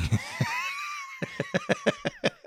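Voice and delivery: big burst of real laughter. Real, not acted. Friend's joke about savvy son.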